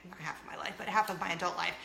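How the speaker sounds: ordinary talk, nothing marked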